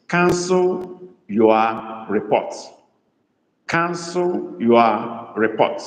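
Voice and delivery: very slow, word by word; slow dictation. Shown as a demonstration. Igbo tutor giving students a sentence to translate, slow dictation. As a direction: dictate it slowly, stretching each word.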